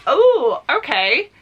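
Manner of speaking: sudden high, loud oh of discovery; a delighted gasp at the sprayed edges; surprised oh. Real, not acted. Unboxing a book and spotting the sprayed edges, surprised delight.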